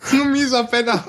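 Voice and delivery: high, hammed-up insult; play-angry at your friend; high mock-indignant insult; mock outrage.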